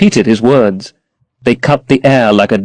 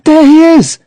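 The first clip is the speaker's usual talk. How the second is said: high, loud thrilled cry; sudden high delighted shout; excited cry; 5 dB louder than usual. A narrator's reading. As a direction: call out at the sight of her lover. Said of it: "she cried".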